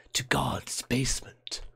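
slow eerie whisper; creepy whispered title. Acted. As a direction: breathe the title like a horror trailer.

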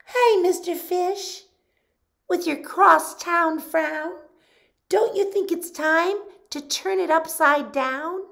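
high bright clam voice; sing-song friendly clam; cheery clam voice. Acted. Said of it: "pearl of advice".

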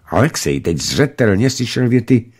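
ordinary delivery, nothing marked

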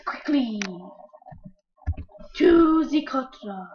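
loud, slow heroic command; rally like a cartoon hero; mock heroic call. Gamer hamming up a heroic order, loud and slow. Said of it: "why I talk like that".

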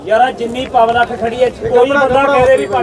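loud, high crowd call. Real, not acted. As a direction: shout it out to the whole market. Seller addressing the crowd.

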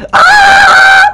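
sudden piercing shriek; startled high scream. Real, not acted. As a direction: scream as if something jumped at you.

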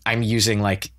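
ordinary talk, nothing marked

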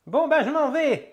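put-on high, slow old-woman voice; old dear backing off, drawn out; old lady. Old woman leaving after a threat, old-lady voice. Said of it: "l'ancêtre".

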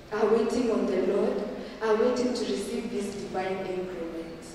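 ordinary delivery

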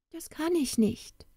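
high-pitched, reluctant refusal; high storybook woman, apologetic; worried young woman. A narrator's reading.

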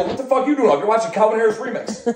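half-joking exasperated yell at a roommate; retold yell at roommate. Retold yelling at roommate Lou.

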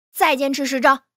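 loud coach's push; urging on. Acted. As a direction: press the flagging pupil on, loudly. Coach pushing exhausted pupil on.

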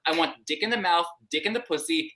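ordinary delivery

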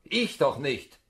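loud dismissive retort; officer's loud denial; sharp rebuff. Acted. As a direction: brush him off loudly.

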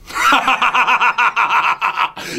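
booming evil laugh; big villain laugh. Acted. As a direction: laugh like a triumphant tyrant. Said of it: "do the big laugh".

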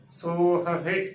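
ਸੋਹਹਿ as so-ha-he; correct pronunciation. Shown as a demonstration. Teacher repeating for students, ਸੋਹਹਿ with two separate h sounds. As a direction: keep both h sounds apart in ਸੋਹਹਿ. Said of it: "ਇਸੇ ਤਰੀਕੇ ਦੇ ਨਾਲ ਹੀ ਉਚਾਰਨ".

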